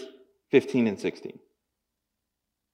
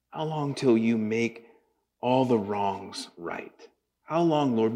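low, soft, slow lament; tender sermon prayer; hushed prayer; 5 semitones lower, 6 dB quieter, slower than usual. Acted. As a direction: pray it quietly, aching.

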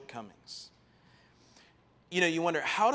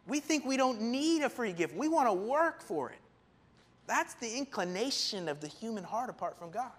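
ordinary talk, nothing marked

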